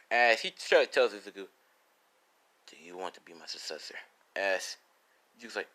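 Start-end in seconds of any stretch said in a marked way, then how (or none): 2.7-3.9 low, very soft question; solemn offer. Acted. Ryu asks Izuku to succeed him, quietly solemn. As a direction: ask gravely in a hushed low voice.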